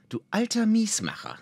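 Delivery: high-pitched mock scolding; playful teasing. A narrator's reading. Elf woman ribbing her unimpressed companion, high playful teasing. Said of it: "boxte ihm spielerisch auf den Oberarm".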